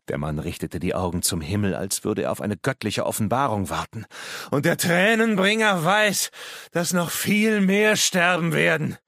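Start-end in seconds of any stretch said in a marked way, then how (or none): 4.5-9.0 very high, loud proclamation; crazed prophet. Acted. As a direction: cry the prophecy out to the sky. Madman prophesies many more deaths. (irrer Prophet, laut und schrill verkündend)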